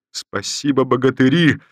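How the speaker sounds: general's hearty shout of thanks to his men; energetic shout. Acted. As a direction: ring out Спасибо to the whole company. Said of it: "энергично крикнул он роте".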